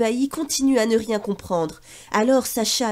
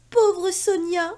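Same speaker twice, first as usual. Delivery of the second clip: sobbing; 6 semitones higher, slower than usual. Acted. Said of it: "sanglote-t-il".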